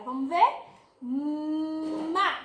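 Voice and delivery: ordinary talk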